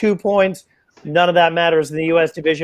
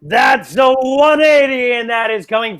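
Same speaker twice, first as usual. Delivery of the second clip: excited shout; 7 semitones higher, 4 dB louder than usual. Real, not acted. Big 180 thrown, commentator erupts.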